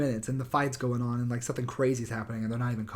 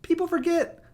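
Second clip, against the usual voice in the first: high, loud, mocking Rogan voice; mock Joe Rogan; 12 semitones higher, 3 dB louder than usual. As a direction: parody him squeaky and smug. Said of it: "he's just like".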